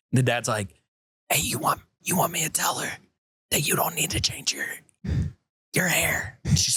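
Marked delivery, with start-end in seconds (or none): 1.3-6.4 hushed, secretive whisper; whispered conspiracy. Retold or imitated. Dad secretly whispering to his daughter in the other room. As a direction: whisper so mom won't hear.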